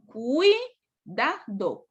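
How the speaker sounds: Cuidado drawn out very slowly, cui-da-do; slow syllable demo. Shown as a demonstration. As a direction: spell out Cuidado slowly so each vowel is heard. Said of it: "Solo tengo dos posibles".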